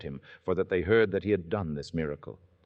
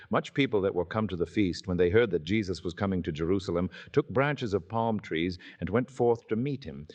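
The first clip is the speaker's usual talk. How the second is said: usual speaking voice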